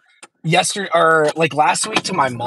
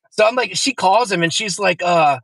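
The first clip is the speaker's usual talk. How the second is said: normal talking voice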